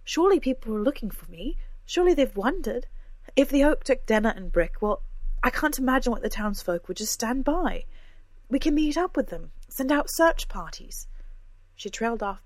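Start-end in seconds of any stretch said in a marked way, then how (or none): 0.0-11.1 higher and louder, rushing urgency; agitated insistence. A narrator's reading. Cora urges the friends to search, loud and agitated. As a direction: insist you're fine and push for action.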